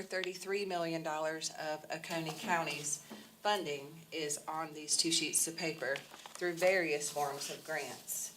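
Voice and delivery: ordinary talk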